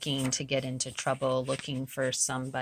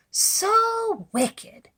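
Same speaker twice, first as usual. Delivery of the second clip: gleeful whisper; 6 dB louder than usual. A narrator's reading. Wolf gloating after eating.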